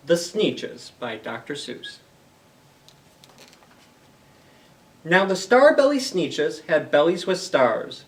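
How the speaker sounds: animated picture-book narration; storybook reading. Acted. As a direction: bounce the rhymes for young listeners. Reads Dr. Seuss aloud to kids.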